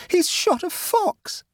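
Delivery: very high, drawn-out cry of dismay; horrified wail. Acted. Nora learns a fox is dead, wailing in horror. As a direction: wail it slowly, aghast. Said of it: "she wailed".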